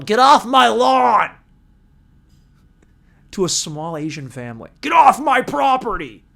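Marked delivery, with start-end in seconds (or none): 0.0-1.3 high, loud, drawn-out husband's yell; mock angry yelling. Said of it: "as Frank was yelling". 4.8-6.2 high, loud homeowner's holler; mock angry yelling. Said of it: "as Frank was yelling".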